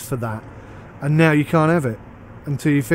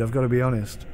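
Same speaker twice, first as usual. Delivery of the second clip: ordinary delivery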